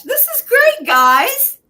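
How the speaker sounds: very high, loud squeal of delight; gushing. Real, not acted. Guest delighted with the group as show ends, gushing. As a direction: gush in a soaring high voice.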